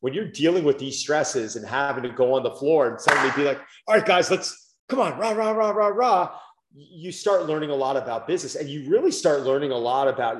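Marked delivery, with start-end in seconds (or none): 3.9-6.3 high, hammed-up rah-rah cheerleading; mock pep rally. Gym owner mock-rallying his stressed staff, high rah-rah cheer. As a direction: pump up the team like a cartoon cheerleader.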